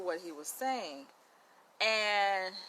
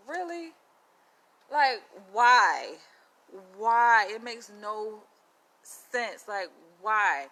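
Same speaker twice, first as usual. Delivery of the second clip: exasperated disbelief; 4 semitones higher, 11 dB louder, much slower than usual. Retold or imitated. Her own reaction to the threat.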